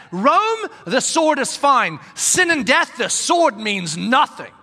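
Rome and Sin pushed high and loud; preacher driving the point up and loud; emphatic preaching. Real, not acted. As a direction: hammer the contrast home.

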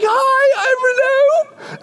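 high, excited fan voice, stretched out; nervous fan introducing himself; giddy fan. Acted. Acting excited fan meeting author.

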